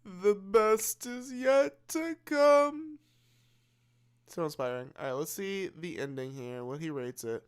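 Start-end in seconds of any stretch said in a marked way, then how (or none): 0.0-3.0 mock inspirational. Mocking repeat of the reviewer.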